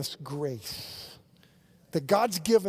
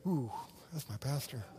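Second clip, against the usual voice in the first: disappointed aside; 4 semitones lower, 9 dB quieter than usual. Retold or imitated. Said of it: "you'd be like".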